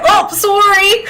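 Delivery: high, laughing Canadian apology; mock Canadian accent. Teasing a Canadian on Discord, mock Canadian accent. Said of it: "and I always say".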